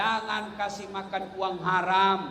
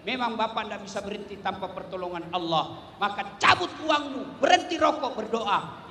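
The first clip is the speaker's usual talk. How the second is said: normal talking voice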